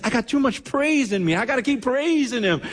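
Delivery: high-pitched, fervent exclamation; exuberant praise. Retold or imitated. Patient refusing to rest after surgery, exuberantly praising. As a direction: imitate someone bursting with praise, pitched high.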